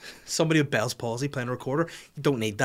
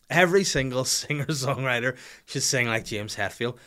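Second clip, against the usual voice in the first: usual speaking voice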